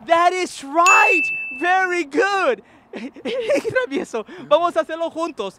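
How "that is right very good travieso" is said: that is right, higher, louder and slow; hammed-up teacher cheering; show-host praise; exaggerated praise. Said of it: "teacher praising the student".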